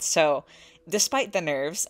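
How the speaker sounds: ordinary talk, nothing marked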